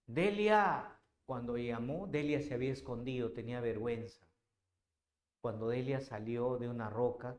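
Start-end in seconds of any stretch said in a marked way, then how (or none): 0.1-1.0 surprised call. Acted. Padre se sorprende, llama.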